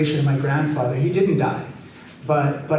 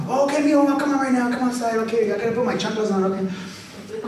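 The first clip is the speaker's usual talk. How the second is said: put-on high, friendly old grandpa voice; 7 semitones higher than usual. Grandpa calling back to his grandson, high and friendly. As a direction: chirp it like a sweet old grandpa.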